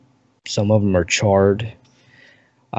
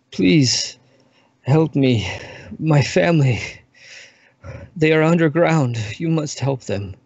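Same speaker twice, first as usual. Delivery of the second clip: higher, pleading and desperate, fading at the end; dying elf's plea; 6 semitones higher than usual. Acted. Dying elf begs the stranger to save his family, desperate and pleading. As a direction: beg for help with your last breath.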